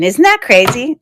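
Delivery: ordinary talk, nothing marked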